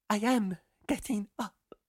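high, weepy, reviving voice; tearful girl. A narrator's reading. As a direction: sob it high as you come round. Fainted girl comes round tearfully.

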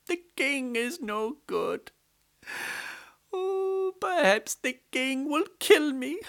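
high, wailing plea to the goddess; crying plea. A narrator's reading. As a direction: wail for the goddess to save you. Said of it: "started crying to the goddess".

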